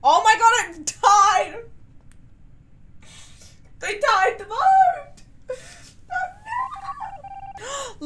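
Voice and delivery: high, loud disbelieving shout; shocked outcry. Real, not acted. Player shouting in disbelief as teammates die. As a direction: yell it out in laughing shock.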